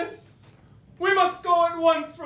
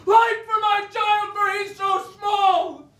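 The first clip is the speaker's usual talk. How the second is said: impassioned shout; 4 semitones higher than usual. Acted. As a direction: pour it out in a passionate shout. Impassioned speech about the child.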